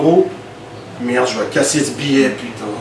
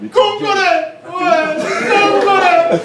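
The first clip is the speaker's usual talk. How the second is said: congolais aigu; slower than usual. Acted. Insiste sur congolais en riant.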